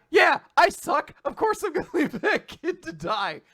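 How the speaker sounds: high, loud self-reproach breaking into laughter; comic high-pitched outburst with a laugh; laughing mock confession. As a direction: blurt out the bully's admission, cracking up. Bully's panicked self-talk, acted while laughing.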